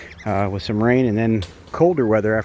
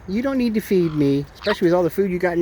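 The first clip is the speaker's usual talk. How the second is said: slightly higher playful voice to the bird; lightly raised pet voice; light pet talk; 6 semitones higher than usual. Real, not acted. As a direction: tease the bird gently. Bird offers food.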